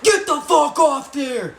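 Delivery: very high, loud, drawn-out yell; imitating a furious guard; guard's angry shout. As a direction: holler it at a kid up on the door. Guard smacks window, yells.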